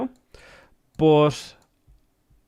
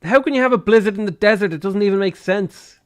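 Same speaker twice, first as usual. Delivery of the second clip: high-pitched indignant complaint; raised, outraged whine; exasperated protest; 6 semitones higher, 3 dB louder than usual. Real, not acted. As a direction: protest in aggrieved disbelief. Protesting game blizzard disaster.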